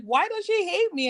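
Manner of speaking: high, offended question; imagined mom. Retold or imitated. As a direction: play the hurt mother. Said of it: "his mom is probably like".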